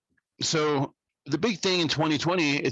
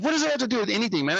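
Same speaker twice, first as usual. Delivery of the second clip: fast, higher, incredulous; retold incredulous question; 10 semitones higher, faster than usual. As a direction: rattle it off in disbelief. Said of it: "I said".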